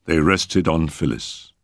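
usual speaking voice

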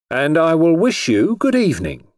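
grand, high-pitched gallant farewell; highwayman manner. A narrator's reading. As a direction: bid farewell with a courtly flourish. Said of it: "in his best highwayman manner".

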